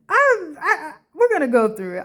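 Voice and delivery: fast excited giggle; excited laugh. Real, not acted. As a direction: blurt it out laughing.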